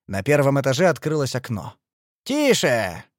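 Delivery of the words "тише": put-on squealed hush from an old man; lightly voiced squealing old codger; old man's squeal. A narrator's reading. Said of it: "взвизгнул, высунувшись, старикан с блестящей лысиной".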